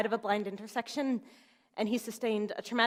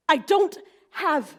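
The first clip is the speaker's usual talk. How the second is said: "I don't have" slow and loud, word by word; emphatic refusal; 6 semitones higher, 8 dB louder, slower than usual. Retold or imitated. Mother telling lawyer she has no money, "I don't have" slow and emphatic. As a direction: land each word of "I don't have" hard and high.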